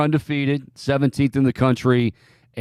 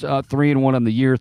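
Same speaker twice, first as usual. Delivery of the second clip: normal talking voice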